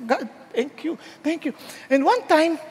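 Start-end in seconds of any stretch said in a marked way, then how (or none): 0.0-1.5 tearful thanks. Acted. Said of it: "Iiyak sa Diyos".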